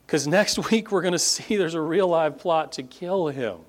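normal talking voice

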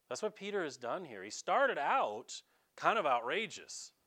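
normal talking voice

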